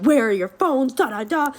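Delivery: hammed-up scolding that runs into filler syllables; mock angry tirade. Stepdad demanding the hidden phones, mock angry tirade. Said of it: "he's just going ham".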